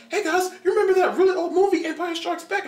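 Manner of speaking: very high, loud excited chatter; squeaky teenage enthusiasm, laughing; eager teen. Retold or imitated. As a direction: pitch it like a giddy kid. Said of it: "he's all like".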